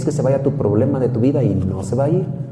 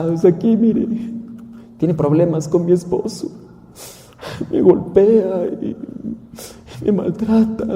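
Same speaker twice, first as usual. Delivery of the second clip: battered wife; 6 semitones higher than usual. Acted. Beaten wife complains to missionary.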